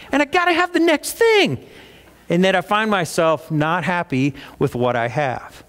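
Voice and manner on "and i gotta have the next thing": much higher, whiny mock voice for wanting the next gadget; mock craving. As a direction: squeal it like a kid who must have the newest toy. Pastor mocking his own gadget craving.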